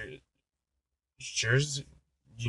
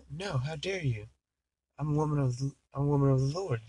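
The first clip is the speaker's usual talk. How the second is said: lightly acted, higher-pitched affronted nun; mock indignant; 4 semitones higher than usual. Nun turning down a hippie on the bus, mock indignant. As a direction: put on a touch of prim outrage.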